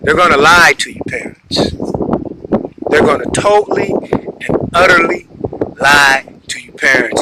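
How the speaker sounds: talking while chewing gum; words round a wad of gum. Real, not acted. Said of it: "I'm chewing gum and swallowing".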